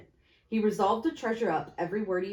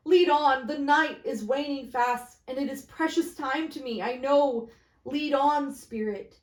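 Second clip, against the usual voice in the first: raised, urgent command; urgent Scrooge; 6 semitones higher, 4 dB louder than usual. Acted. Scrooge urging the phantom forward, raised and urgent. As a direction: push the spirit onward.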